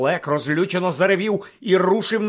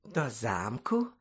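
soft, low, slow silky question; 4 semitones lower, 8 dB quieter, much slower than usual. A narrator's reading. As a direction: purr it back with quiet menace. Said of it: "перепитав шовковим голосом Снейп".

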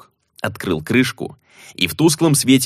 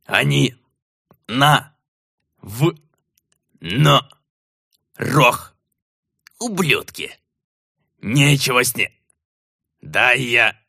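angry gnome; 3 semitones higher, slower than usual. Acted. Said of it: "из всей речи Ветхола я услышал".

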